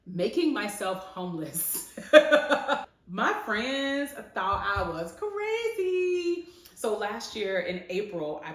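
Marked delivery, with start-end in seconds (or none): 2.0-2.9 a loud, high burst of laughter. Real, not acted. Laughs at "making myself homeless. 3.1-6.5 laughing. Real, not acted. Laughing over her bold move.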